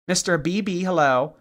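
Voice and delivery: usual speaking voice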